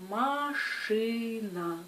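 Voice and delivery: "машина" stretched slowly, each syllable held and linked; slow syllables joined. Shown as a demonstration. Teacher modelling slow reading for beginners, "машина" drawn out. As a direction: draw out every syllable of "машина". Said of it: "lire des mots lentement, syllabe par syllabe".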